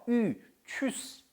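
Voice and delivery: 으 then tschüss, lips rounded for ü; ü in tschüss, drawn out; umlaut demo. Shown as a demonstration. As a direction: model the ü sound slowly. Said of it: "umlaut니까 으".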